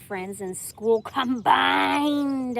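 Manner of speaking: slow, loud, drawn-out reading; incredulous. Real, not acted. Reading a stunning statistic, slow and loud. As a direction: stretch each word in disbelief.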